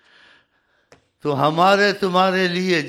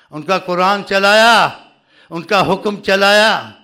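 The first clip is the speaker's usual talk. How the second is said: raised, forceful preacher's voice; impassioned preaching; 3 semitones higher, 6 dB louder than usual. Real, not acted. Preacher challenging followers over loyalty to the master, impassioned. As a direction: hammer out rhetorical questions to the congregation.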